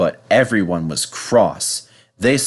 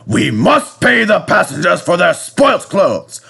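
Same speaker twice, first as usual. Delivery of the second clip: higher, louder rant rising to a shout; building angry scolding; 14 semitones higher, 6 dB louder than usual. Acted. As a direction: work up to a full telling-off. Controller scolding Percy.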